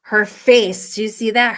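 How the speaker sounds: ordinary delivery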